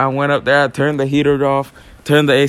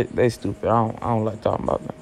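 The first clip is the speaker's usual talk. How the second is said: ordinary talk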